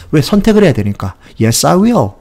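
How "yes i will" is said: firm answer. Shown as a demonstration. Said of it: "의지를 물어봤으면 강하게 들리죠".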